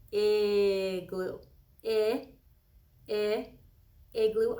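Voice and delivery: "Igloo" with its short i sound stretched; stressed first sound. Shown as a demonstration. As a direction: lean on the first vowel of "igloo". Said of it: "listen to the beginning of that".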